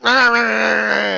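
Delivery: loud, slow mock quarrel noise; mock argument. Couple arguing, in a loud, slow mock quarrel. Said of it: "you and your boyfriend can be like".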